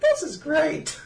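high mock delighted mom; lightly voiced delighted mother; imitating mom. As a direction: chirp it like an enthralled viewer. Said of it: "My mom's in her room watching it".

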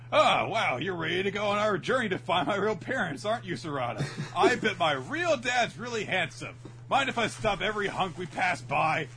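high, loud, gushing impression; Chocho. Chocho gushing to Sarada about finding her real parents, high gushing voice. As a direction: gush excitedly, high and loud. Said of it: "Chocho ninjas behind her and is like".

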